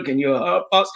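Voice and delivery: ordinary talk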